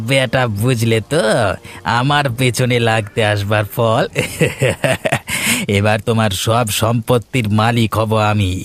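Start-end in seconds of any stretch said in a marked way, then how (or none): 4.1-5.7 gloating villain laugh; evil laugh. Acted. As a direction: laugh in cruel triumph. Villain laughs after pushing him. (খলনায়কের হাসি)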